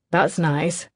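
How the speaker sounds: ordinary delivery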